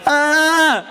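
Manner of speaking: high, drawn-out mock scream. Boy acting out his stabbed friend, mock scream. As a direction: let out an imitation scream of pain. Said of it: "he starts screaming".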